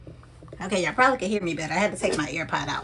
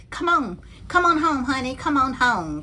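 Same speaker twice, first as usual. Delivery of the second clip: excited calling; 5 semitones higher, 4 dB louder than usual. Real, not acted. Excited longing for the bag.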